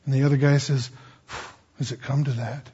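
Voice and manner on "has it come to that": low, soft question after a breath; hushed dismay. Acted. Soldier answering his foxhole partner, hushed dismay. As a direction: ask it under your breath, low.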